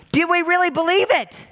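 challenging preacher's question. Acted. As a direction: press the congregation. Challenging the congregation.

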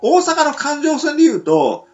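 ordinary talk

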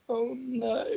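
high, soft "oh, no"; mock sympathy. Mother recalls her pretend-pitying reply to daughter, soft and high. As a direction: feign pity, softly.

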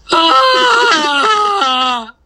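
loud high shouted roar; roar attempt. Shown as a demonstration. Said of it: "wait, let me try again".

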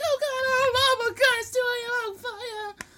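high, loud hysterical wail; hammed-up panic, shrieked high; mock panicked screaming. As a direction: imitate a barracks of terrified recruits. Panicked recruits acted out screaming.

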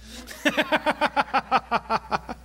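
long staccato laugh; real laugh in a rapid string of pulses. Real, not acted. Laughs at his own remark.

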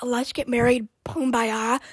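normal talking voice

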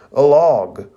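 loud, high cry of alarm; startled shout. Acted. Zaku shouting out as the log swings in. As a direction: blurt it out loud in shock. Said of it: "Zoku even exclaims out loud".

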